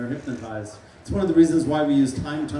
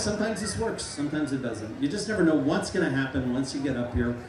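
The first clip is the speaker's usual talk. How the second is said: usual speaking voice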